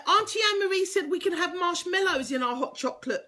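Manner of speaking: lightly voiced excited child; child's voice. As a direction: ask mum like an eager child. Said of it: "Kids, say to mum".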